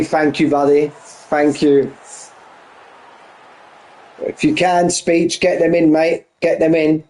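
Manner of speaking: normal talking voice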